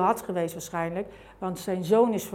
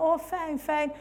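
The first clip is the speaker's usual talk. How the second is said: joyful; 8 semitones higher, much slower than usual. Retold or imitated. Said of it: "stond bijna te dansen in de kamer".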